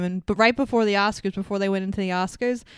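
ordinary talk, nothing marked

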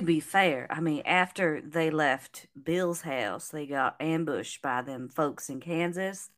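light Southern drawl; Southern accent, reasoning it out. Pretend. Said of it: "I went from Southern to British".

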